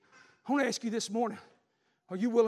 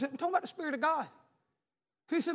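ordinary delivery

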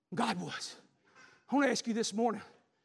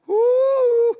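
loud, high laughing whoop; joyful whoop; 13 semitones higher, 14 dB louder than usual. Real, not acted. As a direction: let out a joyous woo. Preacher overcome with excitement.

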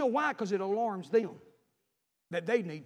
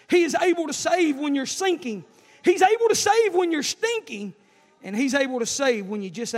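loud, raised proclamation; emphatic preaching; 6 semitones higher, 9 dB louder than usual. Real, not acted. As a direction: proclaim it to the whole congregation, building. Preaches the main point loudly.

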